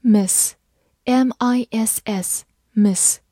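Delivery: very slow, letter by letter; spelled out slowly. Shown as a demonstration. Teacher spelling the word slowly for students. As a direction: spell it out deliberately for learners. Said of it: "字母I发短音A".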